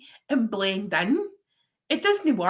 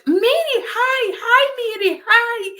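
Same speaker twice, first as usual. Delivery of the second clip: high, loud, drawn-out greetings; imitated excited shouts; 5 dB louder, slower than usual. Tarot folk greeting Mary as she walks in, imitated high shouts. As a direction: call out like a crowd of fans. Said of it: "there was all these shouts".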